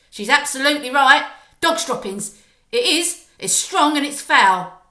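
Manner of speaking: loud, quick, disgusted witch voice; another witch crying out. Acted. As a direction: blurt it out fast, revolted. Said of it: "cried another voice".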